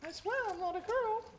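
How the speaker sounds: high squeaky mock girl voice. Streamer mocking the cheater's excuse, high squeaky voice. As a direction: squeak it out like a whiny kid making excuses.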